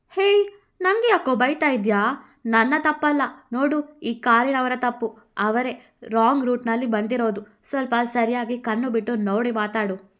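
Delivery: Radha talking back. Acted. Radha answers Krishna back, indignant and loud. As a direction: push the blame away, indignant.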